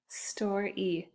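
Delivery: "store E" softly, in two pieces; final E set apart. Shown as a demonstration. Teacher showing the end of each word, "store E" split apart. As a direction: say "store", then a separate long "E". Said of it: "At the end of each word".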